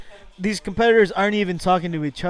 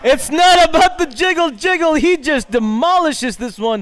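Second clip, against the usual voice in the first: higher, louder burst of excitement; real delight at a monster drive; suddenly shouting with glee; excited outburst; 8 semitones higher, 6 dB louder than usual. Real, not acted.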